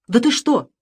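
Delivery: high, loud spike on the middle word; indignant disbelief, nearly shouting; exasperated outburst. A narrator's reading. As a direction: lunge at him with the question. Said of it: "бросился к нему Самсонов".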